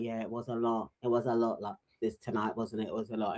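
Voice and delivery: soft, low-key, murmured agreement; hushed Tommy. Retold or imitated. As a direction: say it quietly, almost to himself. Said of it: "Tommy is being like".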